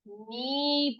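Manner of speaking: नी as slow, long "nee", no k; silent k. Shown as a demonstration. Teacher showing how to read knee, silent k. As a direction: draw out नी starting straight on n. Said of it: "इसको सिर्फ हम पढ़ेंगे क्या?".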